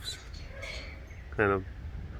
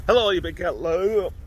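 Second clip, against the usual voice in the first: high loud playful hello; sing-song hello to the cat; high silly greeting; 10 semitones higher, 5 dB louder than usual. Real, not acted.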